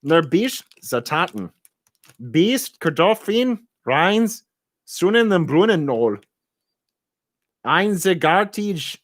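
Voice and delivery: high, slow mock-German reading; hammed-up German accent; funny German accent. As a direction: butcher the words in a comic German accent. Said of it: "the funniest accent ever".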